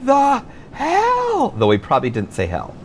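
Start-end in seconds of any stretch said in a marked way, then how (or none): 0.0-1.5 very high, drawn-out shout. A narrator's reading. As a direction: stretch each word in a high-pitched yell. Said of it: "he shouted".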